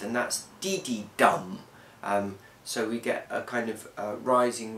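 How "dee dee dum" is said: dee-dee-DUM; anapest beat. Shown as a demonstration. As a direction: rattle off dee-dee then land on dum.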